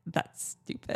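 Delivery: put-on flat, breathy kid scoff; unimpressed kids. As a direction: scoff it like a bored child. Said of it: "And they're like".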